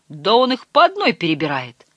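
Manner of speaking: plain reading voice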